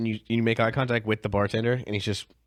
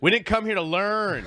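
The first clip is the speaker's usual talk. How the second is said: retold heckle; 7 semitones higher than usual. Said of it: "this dude just yells".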